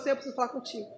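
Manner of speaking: low, soft plea to God; quiet, reverent request; hushed prayer. Acted. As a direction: speak softly, as if privately asking God. Acting out a humble prayer to God.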